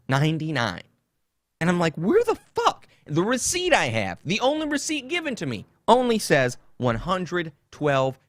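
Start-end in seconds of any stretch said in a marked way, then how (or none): 1.9-2.9 1 stunned outburst. Retold or imitated. Retelling shock at phone charges.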